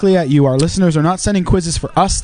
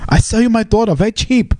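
high, hammed-up Chinese accent; mock Chinese accent; 6 semitones higher than usual. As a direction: put on a crude Chinese accent, pitched up. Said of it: "makes it sound like Confucius".

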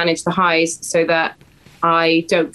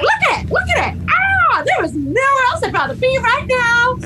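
much higher, rapturous cries; ecstatic exclaiming. Retold or imitated. Driving in Wales, calling out in high rapture at the view. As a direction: burst with joy at the view. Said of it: "talking to myself".

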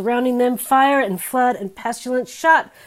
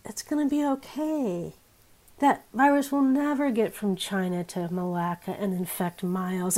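soothing husband; 4 semitones lower, 7 dB quieter than usual. Retold or imitated. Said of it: "he might have said".

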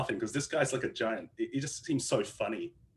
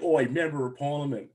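half-voiced "oi" shout, pitched up; retold yell; 4 semitones higher than usual. Cartoon Aussie yelling at his MP, mock yell. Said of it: "he literally yells at them".

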